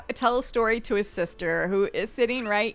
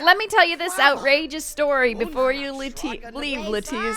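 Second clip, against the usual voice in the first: a higher, put-on young man voice; lightly voiced character bit, a touch higher; Andrew's voice; 4 semitones higher than usual. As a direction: voice the sim boasting about his outrageous story. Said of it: "He's like".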